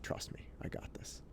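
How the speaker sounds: confident hushed whisper. Retold or imitated. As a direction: reassure her under your breath. Whispering back to wife.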